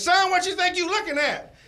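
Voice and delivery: high, loud mock yell; imitated angry scream. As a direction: holler it as an indignant challenge. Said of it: "he screamed at me".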